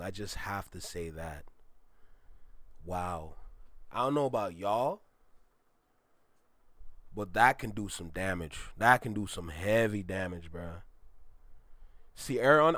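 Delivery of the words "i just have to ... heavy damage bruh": much lower and softer, stunned; low awed murmur. Real, not acted. Giving his verdict on the song, low and hushed. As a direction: let the awe sink the voice down.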